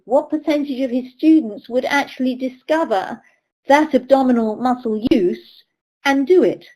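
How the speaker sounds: usual speaking voice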